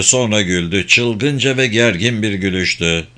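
usual speaking voice

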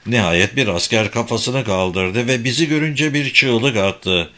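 usual speaking voice

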